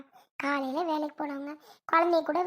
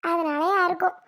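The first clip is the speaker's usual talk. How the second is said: normal talking voice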